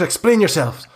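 high, frantic priest demanding answers; Father Lucian's panicked voice. Acted. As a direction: pitch way up, alarmed and bewildered. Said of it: "Father Lucian just goes".

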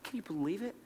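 very soft, marvelling question; hushed disbelief. Acted. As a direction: ask it barely aloud, in wonder. Repeats the question in hushed awe.

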